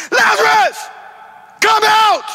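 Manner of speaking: high, loud commanding cry; aggressive shout. Acted. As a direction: command the dead man to rise. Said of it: "Jesus gets aggressive".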